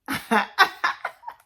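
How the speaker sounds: bursting into a high laugh; high giggle. Real, not acted. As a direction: crack up laughing at yourself. Cracks up at herself.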